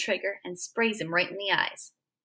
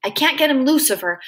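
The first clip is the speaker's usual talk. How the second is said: ordinary delivery, nothing marked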